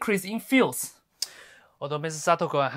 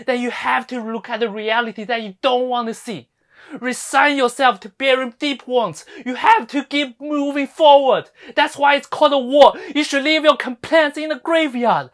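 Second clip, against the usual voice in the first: loud, high battle speech; rally them like troops to war; rousing harangue; 7 semitones higher, 9 dB louder than usual. Acted. Rousing harangue calling them to fight.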